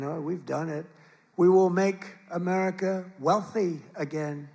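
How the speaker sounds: booming rally climax, rising to shouts; rally speech. Acted. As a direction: build the crowd to a roaring finish. Rally closing crescendo.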